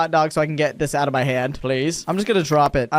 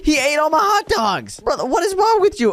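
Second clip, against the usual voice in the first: very high-pitched outraged cry; exasperated outburst; 11 semitones higher than usual. Real, not acted. Park owner sees guest ate all the hot dogs, high outraged cry. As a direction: protest in a squawking high voice.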